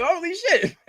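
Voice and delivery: high, amazed holy shit; mock amazement at the shirt. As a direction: blurt it out in wonder. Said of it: "I was like".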